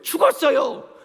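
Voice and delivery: loud, high-pitched retort; acted cry of contradiction; insistent protest. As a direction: fling it back as the sisters' protest. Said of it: "그런데 뭐라 그래요?".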